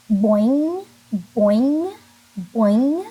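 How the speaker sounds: loud boing rising at the end; bouncy boing. Shown as a demonstration. Teacher bouncing boing for kindergartners, loud rising. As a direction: bounce each boing upward. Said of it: "Your lips kinda bounce".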